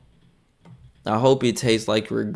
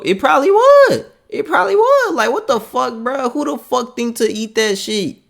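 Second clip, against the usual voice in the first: much higher and louder, incredulous; high-pitched outraged rant; 10 semitones higher, 5 dB louder than usual. Real, not acted. Reacting to the video, high outraged disbelief. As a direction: squeal it out in disbelief.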